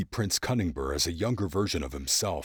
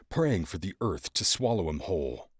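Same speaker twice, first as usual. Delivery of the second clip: usual speaking voice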